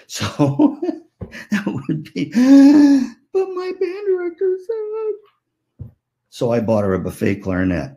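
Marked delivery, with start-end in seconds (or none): 2.3-3.1 dramatic gasp. Retold or imitated. Niece protests aunt's refusal. 3.3-4.9 put-on very high, whining teen girl; whiny teen protest. Niece pleading with her aunt, high whiny wail.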